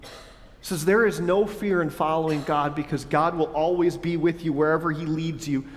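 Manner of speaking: usual speaking voice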